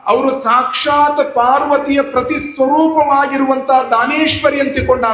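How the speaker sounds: high, ringing sermon proclamation; preacher heralds the holy mother, high and ringing; preaching at full force. Acted. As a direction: proclaim her arrival to the crowd.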